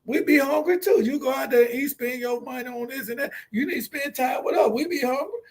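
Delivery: lightly voiced whiny kid complaining; imitating the daughter. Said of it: "that's what she's saying".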